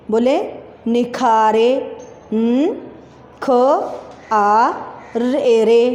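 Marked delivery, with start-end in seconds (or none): 2.3-5.8 careful letters for pupils; spelling out letters. Shown as a demonstration. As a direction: build निखारे letter by letter.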